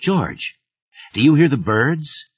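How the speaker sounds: pressing, urgent. A narrator's reading. Writer pressing the killer about the birds, urgent. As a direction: push the question at him hard.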